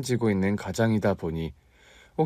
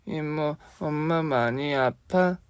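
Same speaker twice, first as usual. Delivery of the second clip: put-on small worried child asking; 6 semitones higher, much slower than usual. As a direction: voice a young boy, higher and slow. Child Seojun asks aunt.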